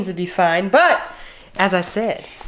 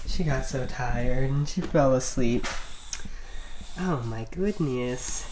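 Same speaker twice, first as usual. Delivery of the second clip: hushed; 6 semitones lower, 9 dB quieter than usual. Real, not acted. Baby asleep, speaking hushed.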